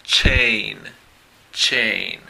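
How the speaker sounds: "chain" said twice with a sharp ch; English word demo. Shown as a demonstration. Teacher reading the chart word, careful demo.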